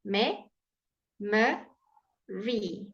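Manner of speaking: me ... muh ... ree, long gaps between; memory broken into three separate sounds; syllable by syllable. Shown as a demonstration. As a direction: isolate each piece of memory for learners. Teacher splits memory into syllables.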